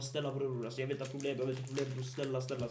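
low, hushed imitation of begging; soft pleading. Begging the other man for no problems, softly. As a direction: beg off quietly, repeating yourself. Acting out a refusal to fight.

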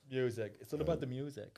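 hammy fake Swedish accent. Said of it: "a fake Swedish guy".

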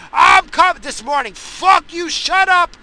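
high, loud yell at barking dogs; furious shout at the neighbor's dogs. Retold or imitated. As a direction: scream at the dogs to shut up. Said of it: "heard me yell at the dogs".

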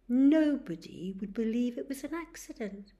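usual speaking voice

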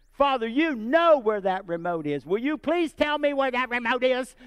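loud, pushy prayer at God; demanding prayer. Retold or imitated. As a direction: pound on heaven's door for the answer. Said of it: "You never prayed like that before?".

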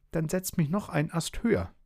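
very high, small character voice; bossy little Schnuddel. A narrator's reading. Schnuddel tells the dog to lift him, high and bossy. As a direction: order the big one about in a high pipe.

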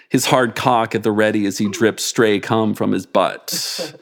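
usual speaking voice

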